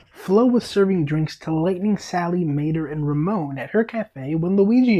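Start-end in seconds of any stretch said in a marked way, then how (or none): none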